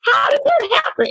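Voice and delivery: a startled stranger's outcry, rising sharply; shocked exclaim. Retold or imitated. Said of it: "they looked at me like".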